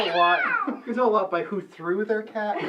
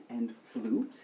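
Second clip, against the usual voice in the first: whispering; 9 semitones lower, 8 dB quieter, slower than usual. Acted. Aside whispered casting.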